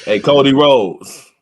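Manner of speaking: loud, slow call-out. Pretend. Co-host calling out Cody Rhodes, loud slow summons. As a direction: summon him loudly, stretching the name. Calls out absent wrestler.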